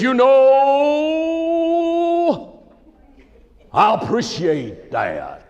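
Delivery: hammed-up preacher, high drawn-out opening dropping to slow gratitude; preacher's voice. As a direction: stretch it like a theatrical pulpit speaker. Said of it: "that's the way he talked right there".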